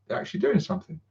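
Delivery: sarcastic mock surprise at Games Workshop. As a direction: fake amazement, quickly. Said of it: "it'll be".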